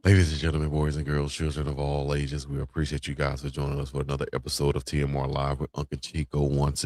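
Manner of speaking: low show host sign-off; announcer voice. Acted. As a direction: roll it out like a broadcast host. Show outro announcer read.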